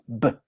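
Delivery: "B" with both lips shut, a stop; bilabial B. Shown as a demonstration. Teacher shows the class the initial B with closed lips. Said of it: "con el, los dos labios cerrados".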